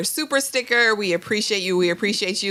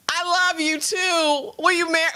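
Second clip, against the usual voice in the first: mock lovestruck; 9 semitones higher than usual. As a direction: stretch it out like a dreamy fool. Mocking a rushed proposal.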